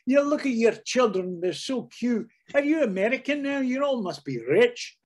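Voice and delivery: high, gushing Glaswegian auntie; Scottish relatives. Retold or imitated. Glasgow relatives welcoming the visiting American family, high Scottish gush. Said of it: "We'd be in Glasgow".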